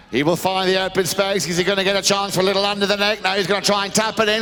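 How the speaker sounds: quick call climbing to high-pitched cries; live play-by-play. Real, not acted. Commentator follows Charlton's shot at goal, rising excited play-by-play. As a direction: build from brisk commentary into an excited high shout.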